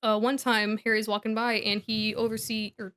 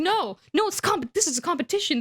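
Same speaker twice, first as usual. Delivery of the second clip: higher, insistent contradiction; mock heated objection; 7 semitones higher than usual. Boy contradicts friend in quarrel, imitated exasperation. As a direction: cut in and argue back.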